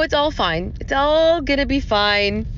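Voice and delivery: normal talking voice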